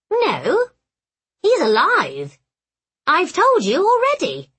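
high, swooping, indignant goblin; exasperated hobgoblin. A narrator's reading. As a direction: insist like a fussy little goblin. Fenswick corrects Henrietta.

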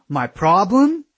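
high, incredulous angry question; indignant echo. A narrator's reading. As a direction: throw the words back in outrage. Aaron snaps back in quarrel.

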